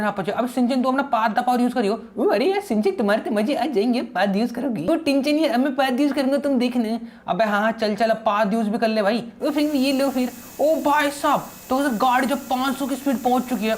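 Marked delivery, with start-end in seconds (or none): 2.2-7.0 childish cartoon-boy voice, a little higher; Sinchan voice. Sinchan teasing his buddy Franky, in a childish cartoon voice. Sinchan excited about farting. 9.4-13.8 childish cartoon-boy voice, a little higher; Sinchan voice. Sinchan teasing his buddy Franky, in a childish cartoon voice.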